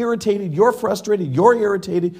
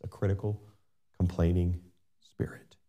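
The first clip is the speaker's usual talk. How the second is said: hushed whisper, breath only; whispered warning; 10 semitones lower, 9 dB quieter than usual. Acted. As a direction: let the words sink into a whisper. Preacher drops to hushed warning.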